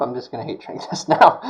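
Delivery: laughing through the words. Real, not acted. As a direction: giggle at the mishap. Laughing at the mishap.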